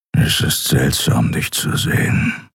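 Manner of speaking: low, slow grumble; a gruff man rumbling it out slowly; grumbled. A narrator's reading. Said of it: "brummte er".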